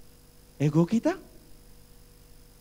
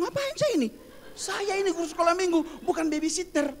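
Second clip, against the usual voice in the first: very high, squealed mock complaint; hammed-up high-pitched indignation; exasperated protest; 10 semitones higher than usual. Sunday school helper handed babies, exasperated protest. Acted complaint about babysitting.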